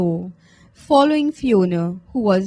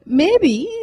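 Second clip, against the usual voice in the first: plain reading voice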